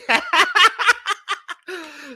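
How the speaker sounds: high, loud laughing fit; cracking up. Real, not acted. Host cracks up imagining the cosplay party, high laughter. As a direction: burst out in real laughter.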